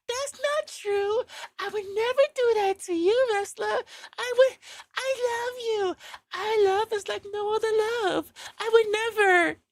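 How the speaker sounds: high, slow little innocent voice; innocent baby voice. Retold or imitated. Girlfriend swearing loyalty to the wrestler in a high baby voice. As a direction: coo your love like a wide-eyed child. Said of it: "the baby voice, the little innocent voice".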